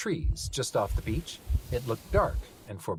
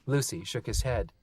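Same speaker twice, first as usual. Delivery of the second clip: plain reading voice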